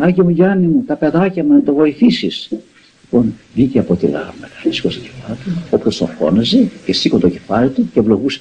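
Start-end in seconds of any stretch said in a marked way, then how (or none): 0.0-2.5 imitating pleading cries. Worshippers beg the saint to help their children, high imitated plea. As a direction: imitate worshippers crying out in high-pitched prayer. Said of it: "Φωνάζαν λοιπόν εκεί πέρα".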